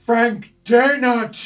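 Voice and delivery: loud, high, slow exclaimed "Frank Daynard!"; a magic shield loudly and slowly crying "Frank Daynard!"; shield's voice. Acted. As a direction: stretch out "Frank Daynard!" as a loud startled cry. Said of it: "a similar voice, but ever so slightly lower key".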